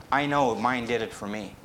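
ordinary talk

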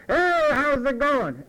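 put-on loud drunk hello; drunk shouted greeting. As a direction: shout it over the din. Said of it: "nobody can hear anymore".

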